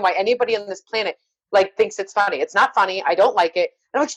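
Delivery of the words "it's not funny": normal talking voice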